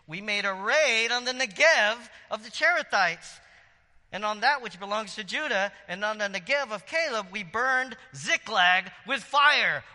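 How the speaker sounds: loud, slow, high booming read. Acted. Reading the raid aloud to the congregation, booming and slow. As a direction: boom it out like a raider's boast. Dramatic reading of Amalekite boast.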